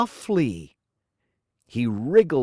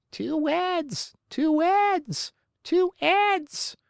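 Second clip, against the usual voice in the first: high squawk, stuffed-nose sound; slower than usual. A narrator's reading. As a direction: squawk it through a blocked nose. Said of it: "Richard squawked".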